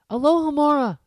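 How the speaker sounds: ordinary delivery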